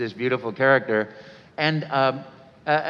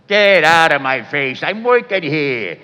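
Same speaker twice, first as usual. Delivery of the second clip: gruff Brooklyn plumber; 5 semitones higher, 6 dB louder than usual. Retold or imitated. First audition idea for an Italian plumber, loud and gruff. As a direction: bark it like a grumpy plumber stuck under a sink. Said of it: "mean, gruff, rotten person".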